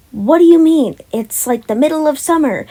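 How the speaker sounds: high, loud disbelieving question; mock viewer asking what do you mean; playful imagined viewer, puzzled and loud; incredulous question.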